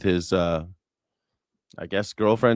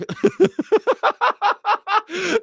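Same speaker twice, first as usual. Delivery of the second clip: high burst of laughter; 4 dB louder than usual. Real, not acted. Big laugh.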